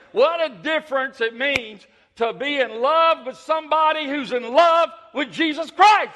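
rising impassioned sermon peak; preaching at full force. Acted. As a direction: lift the room with fervor. Preaching climax.

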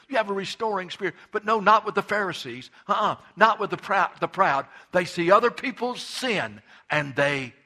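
loud, high revival preaching; fiery Southern pulpit preaching; full-force preaching. Real, not acted. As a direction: preach it hard to the congregation.

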